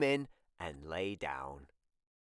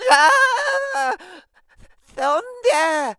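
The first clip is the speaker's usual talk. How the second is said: very high, loud scream; frightened shout; 14 dB louder, much slower than usual. Acted. Tim hearing thunder, very high and loud. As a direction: scream it like a scared kid. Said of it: "shouted Tim".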